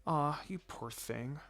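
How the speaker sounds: soft, slow, gentle sympathy; tender sympathy. Acted. Babysitter comforts the scraped-up boy, soft and tender. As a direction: soothe the hurt boy softly.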